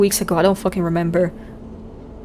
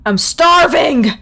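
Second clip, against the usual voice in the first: loud whine; 5 semitones higher, 3 dB louder, much slower than usual. Real, not acted. Streamer suddenly announces hunger.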